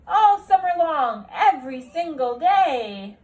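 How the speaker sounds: an ecstatic principal announcing to the whole school; booming principal. Acted. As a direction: proclaim it like an overjoyed headmaster.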